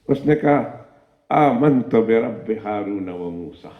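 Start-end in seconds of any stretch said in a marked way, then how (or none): 1.3-3.7 slow, low, weighty creed; queen proclaiming her faith, slow and low; solemn declaration. Acted. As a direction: intone it as a sacred vow.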